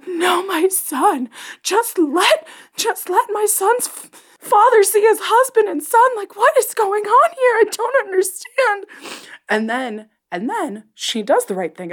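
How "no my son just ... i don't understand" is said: very high, slow, far-off whiny voice; squeaky anguish. Retold or imitated. Host pleading for Grogu's father, very high and whiny. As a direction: wail it in a tiny high voice. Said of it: "And I'm like".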